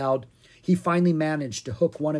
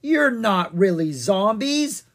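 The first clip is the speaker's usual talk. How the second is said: knowing witch; 7 semitones higher, 6 dB louder, slower than usual. A narrator's reading. Witch unmasks them.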